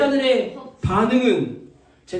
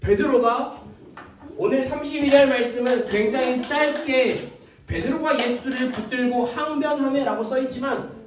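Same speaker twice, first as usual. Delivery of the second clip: ordinary talk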